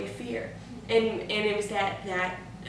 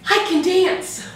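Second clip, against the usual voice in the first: high, loud, slow, bursting with joy; excited announcement; 9 semitones higher, 9 dB louder, slower than usual. Retold or imitated. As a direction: tell her like it's a miracle. Telling leader Amy excitedly.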